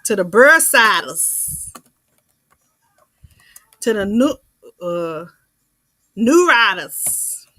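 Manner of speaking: slow, loud southern drawl on bursitis and neuritis; hammed-up southern accent list; southern twang imitation. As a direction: copy the old lady's southern lilt word by word. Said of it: "I gotta say it like she said it".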